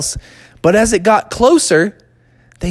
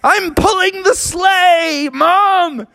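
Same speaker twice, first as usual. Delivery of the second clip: put-on high, drawn-out child voice; Froggy; 12 semitones higher, much slower than usual. Child explains to mom from the sky, high slow call. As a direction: call it down proudly, stretched out. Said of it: "And Froggy was like".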